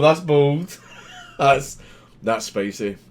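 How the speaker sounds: usual speaking voice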